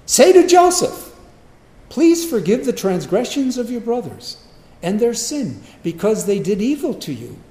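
high-pitched, over-earnest proclamation; phony pleading message. Acted. Brothers relaying dad's supposed command to Joseph, hammed-up pleading. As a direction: plead grandly with a made-up last wish.

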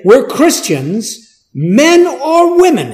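rising, high and loud zealot quote; voicing a zealous Saul. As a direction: voice Saul's fervor. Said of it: "he says".

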